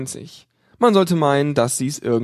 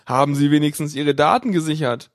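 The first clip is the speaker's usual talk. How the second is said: ordinary delivery, nothing marked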